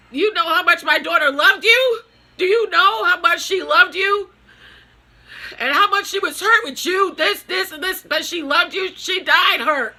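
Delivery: yelling out the hurt like she's facing them; furious outburst. Retold or imitated. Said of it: "And then I'm just so mad".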